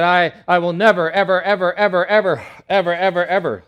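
loud, high, endlessly repeated ever; hammed-up vow. Preacher mocking Peter's endless loud vow to Jesus. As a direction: overdo the promise comically. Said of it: "Peter said".

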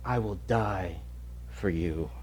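soft, low whispered promise; whispering. Retold or imitated. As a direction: breathe it to him. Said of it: "he whispers".

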